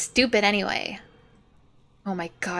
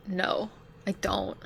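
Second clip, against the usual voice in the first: ordinary delivery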